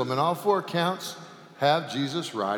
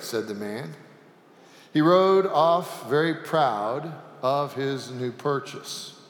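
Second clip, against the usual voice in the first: usual speaking voice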